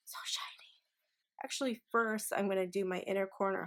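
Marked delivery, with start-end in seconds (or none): 0.1-0.7 breathy awed whisper. Real, not acted. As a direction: whisper it in awe. Admiring the highlighter.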